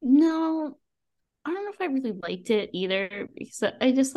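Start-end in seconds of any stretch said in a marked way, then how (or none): none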